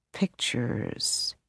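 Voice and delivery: breathless whisper of "Pictures."; soft unvoiced "Pictures." under the breath; whispered echo. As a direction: whisper "Pictures." to yourself in disbelief. Manny echoes word to himself.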